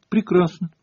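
high-pitched, indignant single word; curt retort. A narrator's reading. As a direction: toss it out sharply, offended. Hermione snaps back at teacher. (высоко, обиженно и отрывисто)

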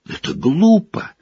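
put-on boy shouting at his teacher. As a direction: yell it out in protest. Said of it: "закричал Гарри".

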